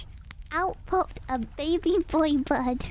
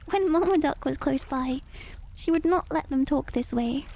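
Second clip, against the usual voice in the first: plain reading voice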